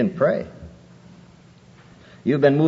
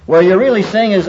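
ordinary talk

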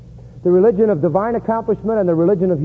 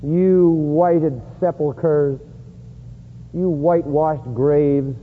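slow, low, measured rebuke; solemn denunciation; 3 semitones lower, much slower than usual. Acted. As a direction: pronounce it heavily, word by word. Preacher declaims Jesus' rebuke.

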